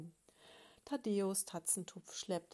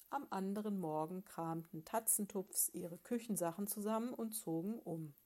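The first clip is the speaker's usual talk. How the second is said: usual speaking voice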